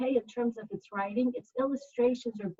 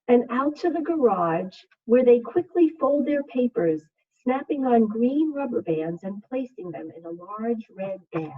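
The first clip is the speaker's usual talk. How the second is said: put-on reading to children; 7 dB louder than usual. Second grade teacher reading a picture book to her class, soothing.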